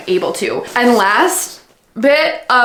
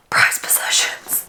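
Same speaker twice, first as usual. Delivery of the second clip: slow, soft whisper; reverent whisper; 6 dB quieter than usual. Real, not acted. Vlogger gushing over her prized books, whispering. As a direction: whisper it slowly, treasuring them.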